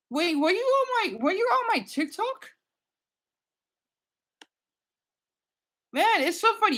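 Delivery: high, amazed question; thrilled to spot a fan; delighted. Real, not acted. Asking a new subscriber about TikTok, high and delighted.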